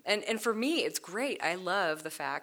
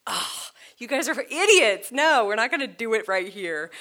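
imitating Moses; 6 semitones higher, 10 dB louder than usual. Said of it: "Moses looks at that and is just like".

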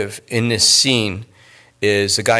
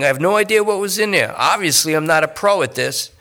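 higher, fast nervous rambling addict; rambling junkie; 9 semitones higher than usual. Acted. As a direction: babble the excuse in a rush. Lester rambles about purse snatch.

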